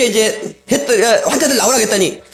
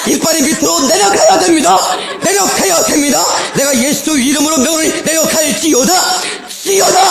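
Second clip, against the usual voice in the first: forceful prayer; 7 semitones higher than usual. Retold or imitated. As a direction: declare it with full power. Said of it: "막 찌어다를 엄청 세게 했어요".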